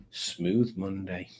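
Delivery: low, slow, breathy-free purr of a stage name; sultry stripper name. Pretend. Host savoring a stripper name, slow and low. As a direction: roll it out like a seductive stage name. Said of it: "sounds like some sort of stripper's name".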